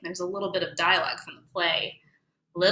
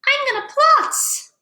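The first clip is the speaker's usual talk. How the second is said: much higher, swooning voice; fainting lady; 4 dB louder than usual. Acted. Miss Matza frightened by the spider, swooning high. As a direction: swoon like a fragile little lady. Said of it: "And Miss Matza goes".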